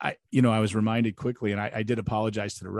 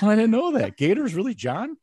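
mock surprise; 12 semitones higher, 7 dB louder than usual. Mock surprised reaction to nicknames.

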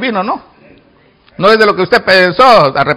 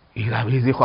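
normal talking voice

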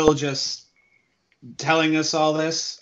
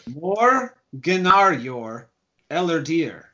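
Morganarior Elardir slowly, in separate measured chunks; slow, deliberate repeat; slower than usual. Acted. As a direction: spell out Morganarior Elardir by ear, drawing it out. Repeats the name after "I'm sorry?.

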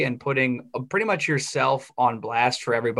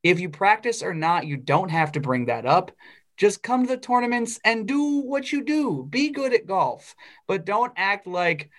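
higher-pitched, mock scolding of an absent player; exasperated telling-off; 6 semitones higher than usual. Fan imagines telling a cocky golfer to shut up, exasperated. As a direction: tell him off, pitch climbing in exasperation.

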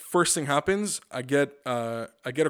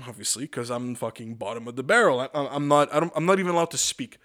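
ordinary talk, nothing marked

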